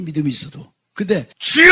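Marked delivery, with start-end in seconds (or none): 1.4-1.7 loud, high shout of prayer; full-force cry for rescue. Acted. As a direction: call out to God at the top of your voice. Acted cry to the Lord.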